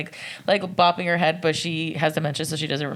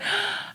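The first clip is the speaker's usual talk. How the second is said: delighted gasp; 3 dB quieter than usual. Retold or imitated. Grandma recognizes her song.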